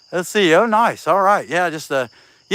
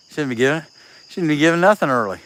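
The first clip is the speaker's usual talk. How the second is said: ordinary talk, nothing marked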